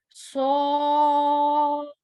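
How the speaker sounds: सौद heavy with echoing d; saad with qalqala. Shown as a demonstration. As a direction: bounce the final d of सौद. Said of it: "कलकला बोलते हैं उसको".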